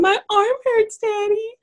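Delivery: very high imitation child voice; mock little-girl whine. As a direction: mimic a hurt kid complaining to Daddy. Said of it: "she was like".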